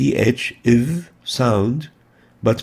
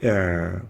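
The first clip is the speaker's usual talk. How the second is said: a squeezed ع from deep in the throat; like someone choking you; صوت العين الحلقي; choking throat sound; 4 semitones lower than usual. Shown as a demonstration. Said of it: "is a throat letter".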